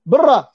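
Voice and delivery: short loud "Bra" thrown out like a scolding grandmother's; grandmother's scolding word. Retold or imitated. Grandmother sending the child out, loud scolding "Bra". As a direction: bark "Bra" to chase a child out of the room. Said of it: "je le dis mal parce que c'est mon accent polonais".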